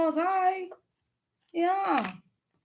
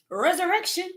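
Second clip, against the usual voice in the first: usual speaking voice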